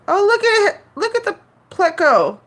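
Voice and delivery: sudden high excited call. Real, not acted. Streamer spots the Pleco in the tank, excited. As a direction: point it out, delighted. Spots fish pulling the plant.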